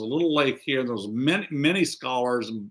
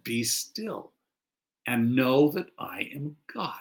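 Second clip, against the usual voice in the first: reverent murmur of the psalm; hushed scripture. Shown as a demonstration. Quoting Psalm softly.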